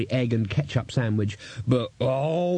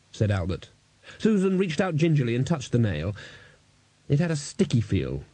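ordinary delivery

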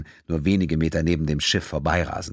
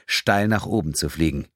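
plain reading voice

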